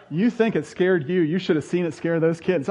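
ordinary talk, nothing marked

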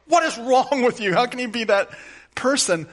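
high, loud exasperated outburst; exasperated judging. Retold or imitated. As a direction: blurt out the judging inner voice. Inner judgmental voice at sinners.

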